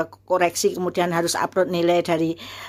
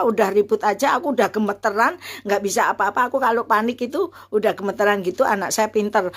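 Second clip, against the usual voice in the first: ordinary delivery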